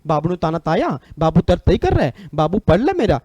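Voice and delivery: mock lisping sweetheart with swooping pitch; lovestruck तोतला talk. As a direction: coo in baby talk, swinging up on the phrase ends. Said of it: "मोहब्बत तोतला ही करती है". (इश्क़ में तोतली बोली की नकल)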